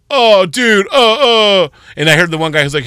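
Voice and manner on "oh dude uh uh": mock dumbfounded teens. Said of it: "they were like".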